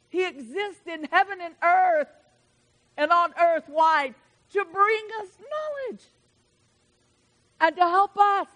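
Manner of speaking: building preacher cry; preaching climax. Acted. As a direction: lift the room with each phrase.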